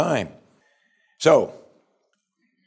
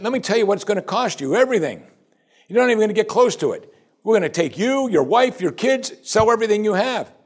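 higher and fast, listing what will be taken; threatening master; 9 semitones higher than usual. Acted. Master threatening the slave with losing everything, fast and harsh. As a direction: rattle off the threat like a harsh creditor.

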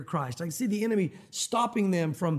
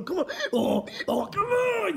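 much higher, effortful pleading; straining tug; 11 semitones higher, slower than usual. Retold or imitated. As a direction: strain like dragging a mule. Dragging a stubborn mule.

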